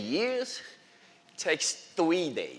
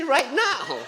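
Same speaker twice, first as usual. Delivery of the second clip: eager laughing reply; 10 semitones higher, 6 dB louder than usual. Retold or imitated. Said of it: "I say".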